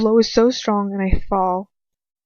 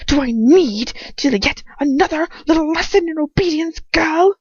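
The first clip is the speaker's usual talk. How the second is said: loud, higher mocking threat; threatening sneer; 7 semitones higher, 7 dB louder than usual. A narrator's reading. Captor threatens the girl with another lesson. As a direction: menace her with scorn.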